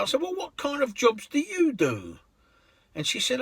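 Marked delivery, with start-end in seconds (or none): 0.2-2.2 much higher curious question; curious driver. Retold or imitated. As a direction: ask with interest.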